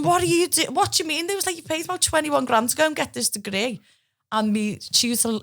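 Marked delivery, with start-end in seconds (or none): 0.0-3.8 high incredulous voices; mock shocked classmates. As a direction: play baffled friends at uni. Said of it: "everyone was going".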